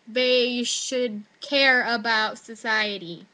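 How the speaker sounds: slow, laboured recital; mock essay composing. Student recites the essay's whole idea, slow mock composing. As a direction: draw it out like filler being written.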